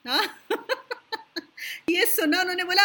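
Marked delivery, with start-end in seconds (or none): 0.5-1.8 soft, high chuckle; amused laugh. Real, not acted. Guru amused by students who think they know it all, chuckling. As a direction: laugh softly at the claim.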